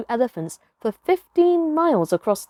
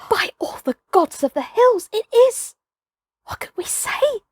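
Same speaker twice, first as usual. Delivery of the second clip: awed; 10 semitones higher than usual. Acted. Tracker overwhelmed by wonder.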